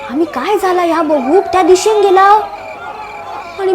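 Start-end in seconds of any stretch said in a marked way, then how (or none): none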